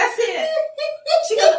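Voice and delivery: ordinary talk, nothing marked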